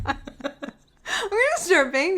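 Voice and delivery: real laughter running through the words; laughing. Real, not acted. Teasing her friend by repeating the slip, laughing. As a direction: barely get the line out for laughing.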